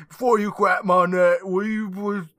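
put-on high, loud botched Aussie accent; hammed-up mangled Australian accent; failed Australian accent. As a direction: push a terrible Aussie accent, loudly. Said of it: "I can't do an Australian accent".

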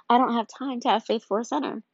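ordinary talk, nothing marked

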